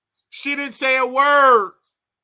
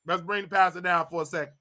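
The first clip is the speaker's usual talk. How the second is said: ordinary talk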